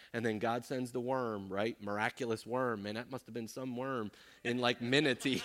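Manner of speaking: usual speaking voice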